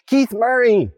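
high, loud imitation of a teacher calling out; retold shout. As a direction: mimic a teacher shouting across to a former student. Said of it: "she shouts at me".

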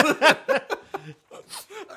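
real laughter; loud high laugh. Real, not acted. Big laugh at own joke.

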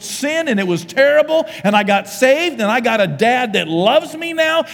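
loud, high-pitched impassioned testimony; full-force preaching. Real, not acted. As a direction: preach it out to the back rows.

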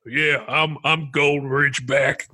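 a hint of a slow cowboy hero; lightly voiced laconic tough guy, unhurried; Sam Elliott type. Introducing the hero as Sam Elliott, lightly voiced and slow. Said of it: "wiry guy with the big mustache".

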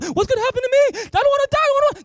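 high, loud panicked wail; mock panic. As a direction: play a prisoner freaking out over his fate. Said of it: "He's not freaking out going".

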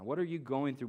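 ordinary delivery

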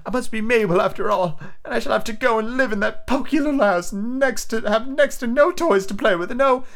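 a tearful hint of stubborn resolve; Alice. A narrator's reading. Alice lamenting being Mabel.

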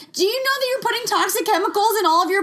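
mock confrontational pitch. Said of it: "you'd be like".

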